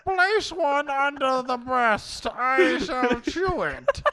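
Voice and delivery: put-on high, slow, pompous old British gent; stomach as a stuffy old English lord, high and drawn out. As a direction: ham up a posh old belly talking to its owner. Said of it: "Talks like an old-ass British dude".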